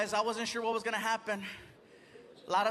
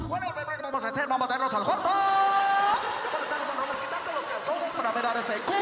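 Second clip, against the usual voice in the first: higher, fast, loud radio-host patter; radio voice at home; 4 semitones higher, 6 dB louder, much faster than usual. Retold or imitated. Radio host talking to his wife, fast and loud. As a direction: seduce the wife in full DJ mode. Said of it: "talking like that to his wife".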